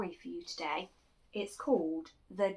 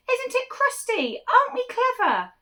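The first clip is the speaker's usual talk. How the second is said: much higher, loud proud cheer; mighty shout; 14 dB louder, slower than usual. Acted. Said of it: "the villagers raised a mighty shout".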